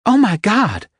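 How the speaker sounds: high panicked outburst. Acted. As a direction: blurt it in alarm. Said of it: "she said in panic".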